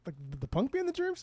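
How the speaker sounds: mock disbelief, rising high; incredulous. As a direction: ask it in disbelief. His own incredulous quote.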